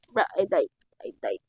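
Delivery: ordinary talk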